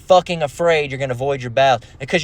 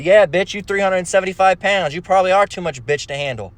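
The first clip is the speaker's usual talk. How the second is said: usual speaking voice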